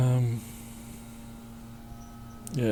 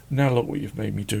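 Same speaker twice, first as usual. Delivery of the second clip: ordinary delivery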